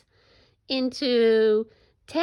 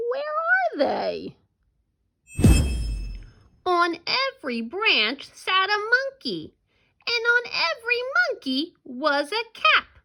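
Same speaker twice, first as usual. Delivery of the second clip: animated storytelling with big swings in pitch; story read-aloud; 7 semitones higher, 4 dB louder than usual. Acted. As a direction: read it to children, drawing out the key moments.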